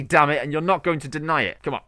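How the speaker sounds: ordinary delivery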